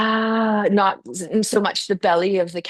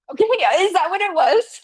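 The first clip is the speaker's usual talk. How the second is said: surprised reaction; 9 semitones higher, 4 dB louder than usual. Retold or imitated. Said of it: "I was like".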